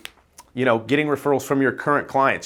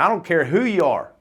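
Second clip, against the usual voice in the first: badly imitated Tupelo accent, pitched higher; horrible Tupelo accent; 5 semitones higher than usual. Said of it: "that was a horrible Tupelo accent".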